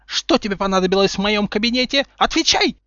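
high, loud angry interrogation; furious demand. A narrator's reading. Furious professor interrogating Harry, angry demand. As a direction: demand it, rising to a shrieked order. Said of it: "Разъярённая профессорша сильнее дёрнула".

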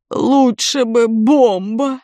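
groaning. A narrator's reading. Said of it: "простонал Герман Никитич".

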